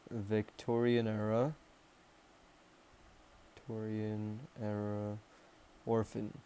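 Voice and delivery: drawn out with long gaps; dictating while writing. Real, not acted. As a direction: say each bit slowly as you write it. Says words while writing them.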